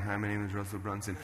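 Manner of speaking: soft, low self-introduction; imitated awkward on-camera intro; awkward former self. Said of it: "how awkward and weird I was".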